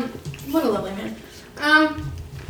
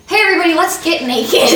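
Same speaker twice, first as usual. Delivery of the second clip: laughing mock call; 6 semitones higher, 6 dB louder than usual. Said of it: "the Halloween dance is just like".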